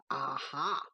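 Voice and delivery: low triumphant horse cry; knowing aha. A narrator's reading. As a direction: catch him out.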